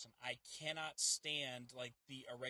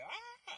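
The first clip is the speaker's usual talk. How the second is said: startled shriek. Retold or imitated. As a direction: imitate a jump-scare yelp, very high. Retells being startled by moving figure.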